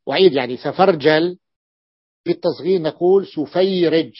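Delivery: usual speaking voice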